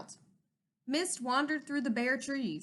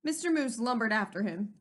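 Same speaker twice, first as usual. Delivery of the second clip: ordinary delivery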